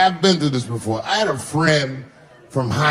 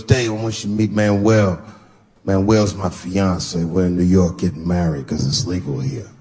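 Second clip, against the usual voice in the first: lower, lightly voiced friend; friend's voice; 8 semitones lower than usual. Buddy introduces fiance to Dave, lower voice. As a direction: drop the pitch for the buddy.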